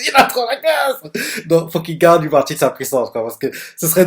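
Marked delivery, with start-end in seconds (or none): 0.1-1.5 much higher, slow, gushing; excited squeal. Real, not acted. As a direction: let the admiration spike up high. Repeats it, fangirling harder.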